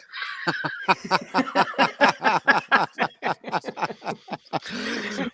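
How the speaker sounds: a long helpless laugh; laughing hard at your own story. Real, not acted. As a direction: crack up for a good while. Wife called him an idiot.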